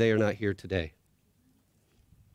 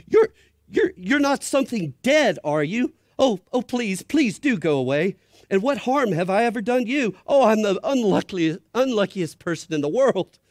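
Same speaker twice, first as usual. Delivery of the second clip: much higher, louder terrified pleading; Shasta, almost screaming; 11 semitones higher, 6 dB louder than usual. Acted. Boy begs the creature he fears is a ghost, near-screaming. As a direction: shriek and beg in terror. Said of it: "almost in a scream".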